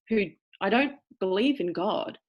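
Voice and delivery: ordinary talk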